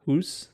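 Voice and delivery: "hoose" with an oo vowel, questioning rise; questioned pronunciation. Shown as a demonstration. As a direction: query house said as "hoose". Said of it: "that's how they say it".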